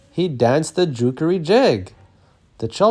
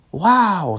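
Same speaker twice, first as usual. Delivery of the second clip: amazed; 7 semitones higher, 4 dB louder than usual. A narrator's reading. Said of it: "said Lucky".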